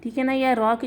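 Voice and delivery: normal talking voice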